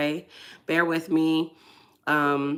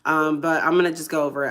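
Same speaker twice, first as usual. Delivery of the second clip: ordinary talk, nothing marked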